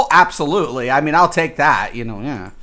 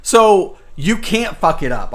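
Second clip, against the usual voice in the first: usual speaking voice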